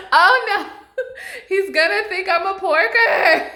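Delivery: high, loud, laughing through the words; half laughing, half wailing in embarrassment; laugh-crying. Real, not acted. Laughing at her own embarrassment.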